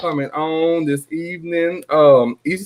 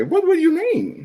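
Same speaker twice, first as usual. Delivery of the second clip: incredulous; 13 semitones higher than usual. Real, not acted. Caught by a teasing remark.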